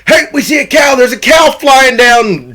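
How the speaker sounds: high, loud mock shout; excited. Retelling the chasers spotting a cow in the storm, excited. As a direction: call out an astonishing sight, hammed up. Retold sighting of flying cow.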